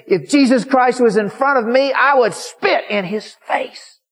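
high, loud retold fury, slowing on the threat; angry outburst. Old woman defies the preacher, furious outburst. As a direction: act out her furious defiance at high pitch.

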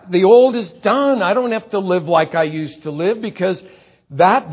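loud, high, fervent preaching; preaching at full force. Acted. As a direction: build to a ringing climax. Preacher voices believer's inner resolve.

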